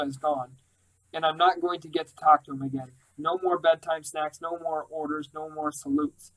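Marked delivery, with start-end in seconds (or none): none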